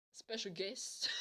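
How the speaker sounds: soft retold question; doubtful echo. He queries the girl's surprise, doubtful. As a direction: hand it back quietly, puzzled.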